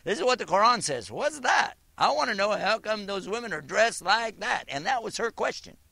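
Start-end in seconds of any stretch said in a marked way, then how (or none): 0.0-4.6 skeptic's retort. Retold or imitated. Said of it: "they say".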